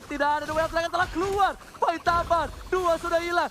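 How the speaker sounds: high, loud play-by-play; esports hype call. Real, not acted. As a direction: call the fight with rising hype.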